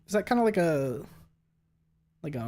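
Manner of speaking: normal talking voice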